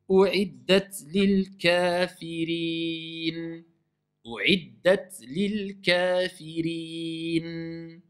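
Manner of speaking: slow chanted U'IDDAT LIL KAFIRIN, breathed T, long I, clear N; every letter audible. Shown as a demonstration. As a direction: stretch the end of kafirin and still sound the N. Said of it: "j'entends le noun, j'entends le i".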